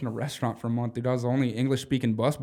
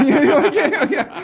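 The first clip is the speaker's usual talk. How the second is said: high, loud laughter through the words; real laughter at own joke; laughing hard; 8 dB louder than usual. Real, not acted. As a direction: crack up mid-sentence.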